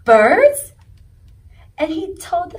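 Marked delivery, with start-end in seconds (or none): 0.0-0.7 much louder, rising amazed question; amazed echo. A narrator's reading. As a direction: echo it in wonder. Fish amazed at birds.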